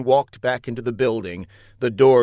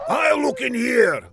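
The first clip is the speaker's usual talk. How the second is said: high-pitched villain's call; high, hammed-up shout; acted shout; 12 semitones higher than usual. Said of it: "Laszlo shouted".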